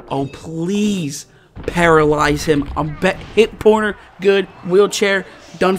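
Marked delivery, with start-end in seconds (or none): none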